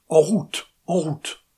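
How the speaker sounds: loud, urgent call to ride on. Acted. José urging his companion on, loud urgent call. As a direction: push them onward, loud and urgent.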